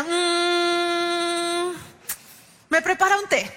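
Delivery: put-on hum, then answer like a small boy; imitating little Lucas. Lucas answers what his mom does, child voice. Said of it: "Le hacía".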